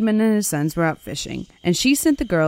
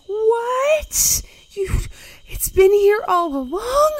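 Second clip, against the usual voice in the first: much higher, louder stunned cry; shocked disbelief; 4 dB louder, slower than usual. Acted. The selkie wife learns the skin was hidden all along, stunned high cry. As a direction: gasp it out, way up high. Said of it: "And she's like".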